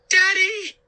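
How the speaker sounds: very high, loud shriek. A narrator's reading. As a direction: shriek in horror. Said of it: "Sari shrieked".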